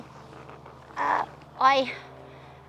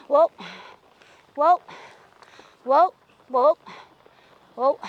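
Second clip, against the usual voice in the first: louder, urgent whoa to the horse; calling whoa; 6 dB louder than usual. Real, not acted. Rider urgently calling her horse to halt, loud. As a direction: stop the horse now, sharp and loud.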